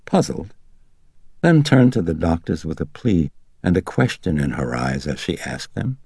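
ordinary delivery

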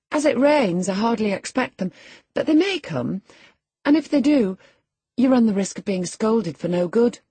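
put-on high, loud girlish voice; young Catherine, warning.

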